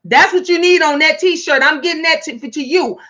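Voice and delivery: ordinary talk, nothing marked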